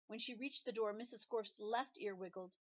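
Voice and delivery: plain reading voice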